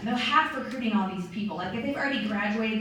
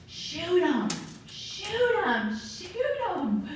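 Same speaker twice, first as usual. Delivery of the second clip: urging; 8 semitones higher, much slower than usual. Real, not acted. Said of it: "urging the audience".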